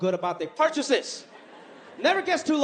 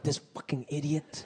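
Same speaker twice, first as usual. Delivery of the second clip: muttered insult; 8 semitones lower, 7 dB quieter than usual. Retold or imitated. Muttered reaction.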